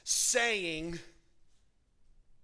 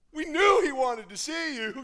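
higher, loud, sweetly fake; mock fawning; 8 semitones higher, 11 dB louder than usual. Crowd fawning over the beggar, fake supportive. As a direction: suck up, suddenly supportive.

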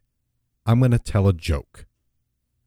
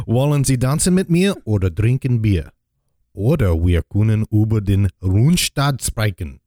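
put-on German accent. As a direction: welcome the audience in hammy German. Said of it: "your, uh, German accent is great".

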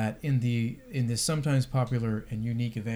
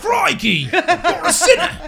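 much higher, loud mock Aussie yell with a laugh; Aussie preacher; 10 dB louder, slower than usual. Mocking the street preacher, loud high Aussie accent. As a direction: shout it like a cartoon Aussie preacher. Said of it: "this Australian".

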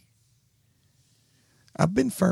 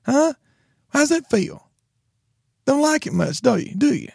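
high, louder taunting scold; 10 semitones higher, 4 dB louder than usual. Retold or imitated. Father retelling scolding his son, high taunting. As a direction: needle the kid, pitched high.

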